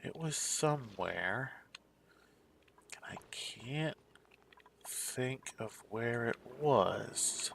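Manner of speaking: slow whispered mumble; mumbling to himself. Real, not acted. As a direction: mutter it to yourself, slowly. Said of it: "while I mumble to effectively myself".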